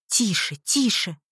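ordinary delivery, nothing marked

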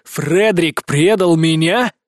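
slow, loud bestial growl. Acted. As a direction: growl it like a wild beast. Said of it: "Рычу, как дикий зверь".

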